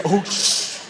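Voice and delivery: voiceless, forceful "oh, shh"; acted stunned half-curse; imitated co-pilot cursing. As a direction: cut the swear off in a long hiss. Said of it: "that's the co-pilot saying".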